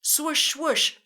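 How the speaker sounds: high, loud swoosh; saucer swoosh. Shown as a demonstration. Teacher swooshing like a saucer, loud. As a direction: whoosh like a flying saucer. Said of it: "your turn to make the sound".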